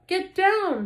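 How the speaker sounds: high, loud urgent shout; girl yelling at her brother to get away from the window; shouted warning. A narrator's reading. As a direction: yell a warning to duck. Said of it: "shouted Annie".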